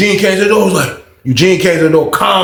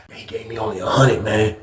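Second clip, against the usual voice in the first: much softer and lower, evasive; sheepish low answer; 8 semitones lower, 7 dB quieter than usual. Retold or imitated. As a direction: mumble the lie out. Answering the question cagily.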